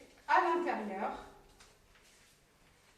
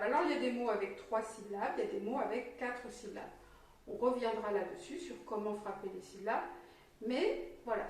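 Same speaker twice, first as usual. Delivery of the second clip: normal talking voice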